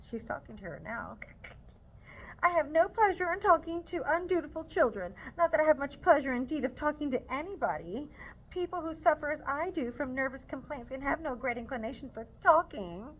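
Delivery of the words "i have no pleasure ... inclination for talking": higher, louder, indignant scolding; scolding mother. Acted. Mrs Bennet scolding Lizzie for refusing, loud indignant scold. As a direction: scold the daughter hard.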